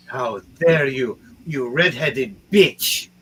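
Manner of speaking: furious villain. Acted. DM voicing the wounded enemy's slow furious threat. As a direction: drag out the insult in rage.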